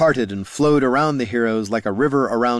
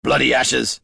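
swearing; 2 semitones higher than usual. Acted. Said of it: "swore Gorm".

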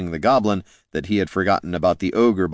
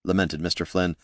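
plain reading voice

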